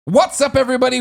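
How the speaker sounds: loud, high host greeting; show host intro. Acted. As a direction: open the show big and bright.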